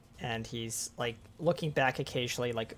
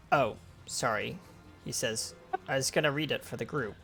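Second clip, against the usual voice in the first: low character voice; 12 semitones lower, 6 dB quieter than usual. Acted. Dracathio apologizes to pixie.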